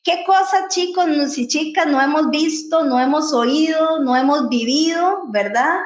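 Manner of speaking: usual speaking voice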